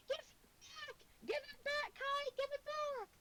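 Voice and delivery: put-on high shrill kid shout; kids yelling. Kids chasing a boy, yelling at Kai. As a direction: chase and demand it back. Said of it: "kids chasing behind them".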